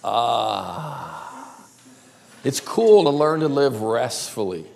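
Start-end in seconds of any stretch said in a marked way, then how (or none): 0.0-1.6 soft, lower, long "ah"; blissful sigh of relief; contented sigh. Shown as a demonstration. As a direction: breathe out as if fully at rest. Relaxed sigh after saying rest.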